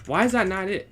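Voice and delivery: ordinary talk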